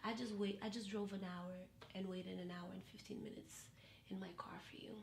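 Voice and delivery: very soft, low retold grievance; sullen reproach. Date who waited in his car, sullen reproach. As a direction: sink into a hushed, low sulk.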